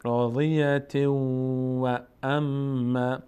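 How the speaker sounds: راضیت و اما chanted slowly, nasal glide into و; tanween merged into waw. Shown as a demonstration. Teacher showing the continued reading, slow chant merging into و. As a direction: carry راضیت into a held و without stopping.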